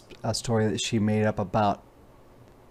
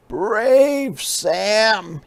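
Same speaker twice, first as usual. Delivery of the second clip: cub voice, much higher, proud; proud little cub; 10 semitones higher, 4 dB louder than usual. Acted. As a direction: praise yourself like a proud child. Sam praising himself.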